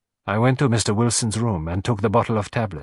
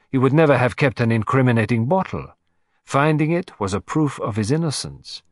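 plain reading voice

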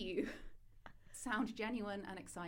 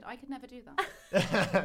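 slow, loud laughing line; 5 semitones higher, 10 dB louder, slower than usual. Real, not acted. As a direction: laugh through the words. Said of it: "you sounded quite excited".

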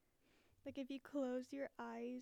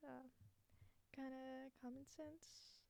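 ordinary delivery

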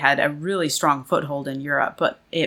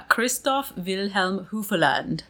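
relishing the German name, pitched up slightly; German pronunciation; 3 semitones higher than usual. Shown as a demonstration. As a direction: pronounce it carefully in a German accent. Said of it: "I just loved pronouncing German things".

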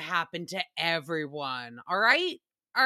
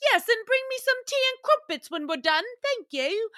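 high, prim mock British voice; hammed-up British accent, much higher; 12 semitones higher, 3 dB louder than usual. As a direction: play the polite British lady asking for tea. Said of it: "I'd be like".

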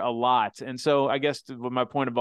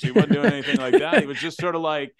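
laughing; 4 semitones higher, 7 dB louder than usual. Real, not acted. Laughing after his own impression.

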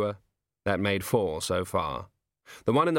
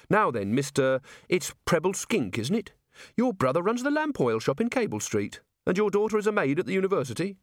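higher, friendly official voice; Carrot, affable watchman; 9 semitones higher than usual. A narrator's reading. Carrot places the merchant by his family, affably official. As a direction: chat knowingly like a local policeman. Carrot shows he knows everyone.

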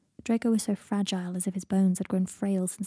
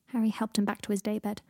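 ordinary delivery

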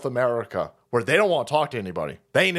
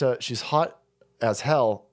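normal talking voice

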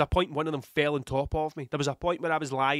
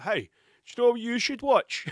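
high, put-on tempting voice; wrestling brain's voice; 8 semitones higher, 3 dB louder than usual. Wrestling brain interrupting a sick man, high put-on voice. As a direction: pipe up like an eager inner voice. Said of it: "the wrestling part of my brain comes out and is like".

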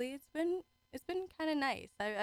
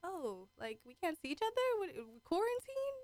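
mock disbelief; 9 semitones higher than usual. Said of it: "and then I was like".

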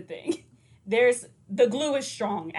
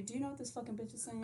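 mock aside; 16 dB quieter, faster than usual. Mocking asking Siri.

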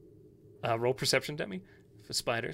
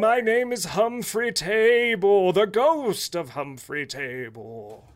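grand ghost voice; 8 semitones higher, 7 dB louder than usual. Acted. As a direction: announce yourself like a proud phantom. Ghost introduces himself.

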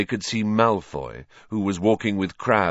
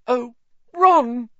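high, loud emotional wail; overcome with emotion; 7 dB louder than usual. A narrator's reading. Hermione flinging her arms round Ron, overcome. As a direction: cry it out, pitch soaring.

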